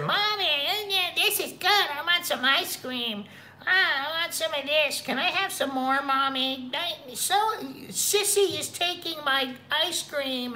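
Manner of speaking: put-on high, childish whine of a small kid; whiny kid voice. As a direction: squeak it out like a demanding little child. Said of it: "And the kids are".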